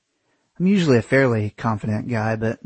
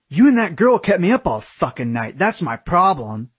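high rant, slowing on the last phrase; angry accusation; 6 semitones higher than usual. A narrator's reading. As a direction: accuse angrily, then drive the point home. Aaron rants in quarrel.

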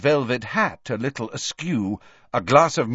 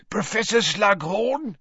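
high, wheezy caretaker's call; Filch, wheezing; 12 semitones higher than usual. A narrator's reading. Caretaker drags a boy to the professor, high and wheezing. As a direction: wheeze it out eagerly, pitched high. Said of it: "wheezed Filch".

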